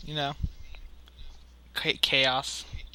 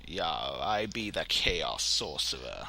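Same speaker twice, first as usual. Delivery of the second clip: mock sorcerer; 7 semitones lower, 5 dB quieter, slower than usual. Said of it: "voicing the sorcerer again".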